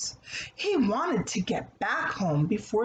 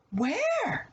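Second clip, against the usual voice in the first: high, eager little pig; 10 semitones higher than usual. Acted. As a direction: pop the question up like a curious piglet.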